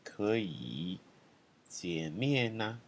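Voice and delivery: slow, drawn-out phrase; slowly modelled phrase; slow syllable demo. Shown as a demonstration. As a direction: stretch each syllable out.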